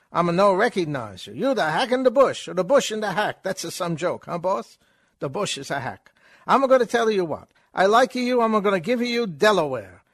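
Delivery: put-on fast, high, brash stage Italian accent; hammed-up vaudeville Italian accent, pushing the joke; Chico Italian accent. Chico offering Groucho Delaware, in a loud stage Italian accent.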